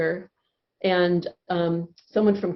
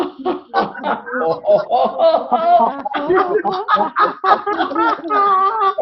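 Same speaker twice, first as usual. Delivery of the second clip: much higher, louder laughing; appreciation laughter; 10 semitones higher, 6 dB louder than usual. Shown as a demonstration. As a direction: laugh to thank everyone. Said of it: "we want to show appreciation".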